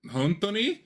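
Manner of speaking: 本当に？と驚いて高い声で; surprised question. Retold or imitated. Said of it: "She goes, um".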